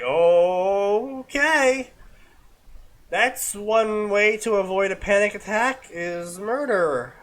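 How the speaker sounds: dry, drawn-out mock commentary; deadpan the ending slowly; sarcastic narrator voice stretching murder; sarcastic aside. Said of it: "And it's like".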